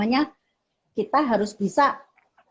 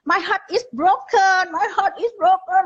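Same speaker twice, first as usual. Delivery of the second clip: high, drawn-out mock wail; tearful child's lament, pitched way up; crying child imitation; 9 semitones higher than usual. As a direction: imitate a heartbroken kid wailing through tears. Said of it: "sambil nangis gitu".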